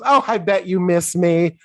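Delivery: high, loud and self-assured; gushing voiceover. Retold or imitated. Phyllis writing a letter to Mary, gushing. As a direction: chirp it high and loud, oblivious.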